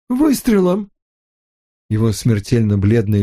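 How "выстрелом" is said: выше и резче, почти вскрик; повтори, осознавая весь ужас; потрясённый повтор. Acted. Отец не верит услышанному. (нарастающий ужас)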